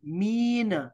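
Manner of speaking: "मीन" with long ee between m and n; spelled-out slang pronunciation. Shown as a demonstration. Teacher spelling out the slang word, long-vowel pronunciation. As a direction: stretch the ee in "मीन". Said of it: "मीम होगा या और नून".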